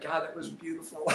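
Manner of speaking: soft words sliding into laughter; quietly cracking up; real amused delight. Real, not acted. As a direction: savor the memory, laughing softly. Delighted at own remembered story.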